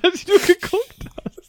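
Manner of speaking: high-pitched laughing through the words; can barely get it out for laughing. Real, not acted. Cracking up at a memory.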